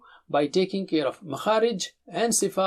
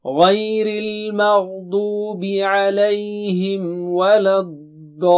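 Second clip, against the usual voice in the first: Quran recitation; 6 dB louder than usual. Acted. Teacher recites Fatiha verse for students to copy. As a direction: recite it like a qari, flowing.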